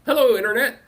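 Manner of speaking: normal talking voice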